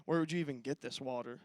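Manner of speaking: quiet, low, smug and sassy; slick teen voice. Retold or imitated. Samaritan woman sassing Jesus at the well, quiet and slick. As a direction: play the sassy Samaritan woman brushing him off. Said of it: "I can just see her being, like, slick".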